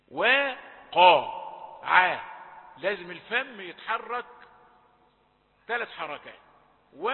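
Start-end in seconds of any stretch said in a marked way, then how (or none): 0.1-2.2 "وقع" in slow separate syllables, thin w; loud, very drawn out, thin wa then dark qa; light waw. Shown as a demonstration. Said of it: "واو رقيقة". 6.9-7.0 "و" as a thin front we; light waw. Shown as a demonstration. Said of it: "واو رقيقة".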